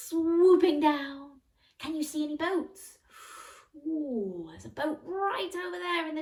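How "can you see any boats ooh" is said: ordinary delivery, nothing marked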